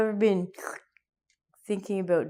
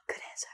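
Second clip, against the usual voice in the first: breathless mock-shocked gasp, then a whispered good answer; 15 dB quieter, much faster than usual. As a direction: pretend to be shocked.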